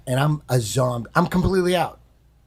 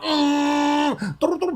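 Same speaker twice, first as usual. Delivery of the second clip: groggy hum; 11 semitones higher than usual. Retold or imitated.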